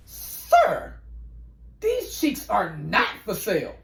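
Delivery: clutch your pearls and refuse him; offended prissy lady. Acted. Turning down a stranger's proposition, prim and offended.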